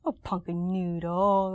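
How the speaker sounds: put-on slow, drawn-out baby talk; motherese. Shown as a demonstration. Mom showing what she says to her baby, drawn-out motherese. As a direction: stretch out each word as if cooing to a baby. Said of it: "give me an example of your motherese".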